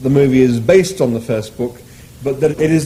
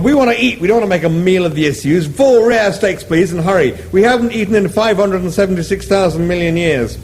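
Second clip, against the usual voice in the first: higher, brash hungry order; impatient Zaphod; 6 semitones higher than usual. Acted. Zaphod orders steaks from the animal, higher and brash. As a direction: demand food fast.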